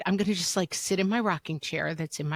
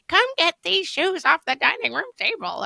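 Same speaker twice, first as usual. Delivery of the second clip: mock shriek; 10 semitones higher than usual. Parent shrieking at kids about shoes on table, mock shriek. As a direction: shriek the chore order at the kids. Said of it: "someone shrieking to me".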